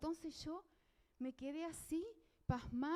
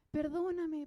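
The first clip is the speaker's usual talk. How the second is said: "Perdóname" cried out louder, falling at the end; louder tearful plea; 3 semitones higher, 6 dB louder, slower than usual. Retold or imitated. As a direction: burst out with the plea. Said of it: "yo empecé a llorar y dije".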